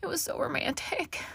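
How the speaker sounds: high, swooning sigh; dreamy sigh. A narrator's reading. Carmel telling her sisters of the proposal, sighing dreamily. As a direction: gush like a lovestruck girl. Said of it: "Carmel sighed and fluttered her eyelashes".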